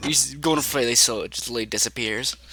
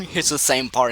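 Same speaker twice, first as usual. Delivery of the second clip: ordinary delivery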